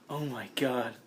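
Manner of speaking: low, soft exclamation; hushed awe. Acted. Player opens up the crate of food, hushed exclamation. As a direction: breathe it out low and quiet.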